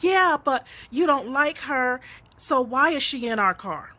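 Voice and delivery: a hint of the little girl pushing back; child insisting. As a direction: daughter insisting from the back seat, lightly childlike. Daughter voiced pressing her point.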